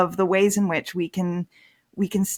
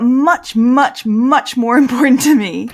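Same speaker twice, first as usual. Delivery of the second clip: each "much" pushed higher and louder, breaking into a laugh; emphatic much; 6 semitones higher, 10 dB louder than usual. Real, not acted. Telling the host that ancestral sites matter more, emphatic and laughing. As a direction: lean hard on "much, much, much", laughing through it.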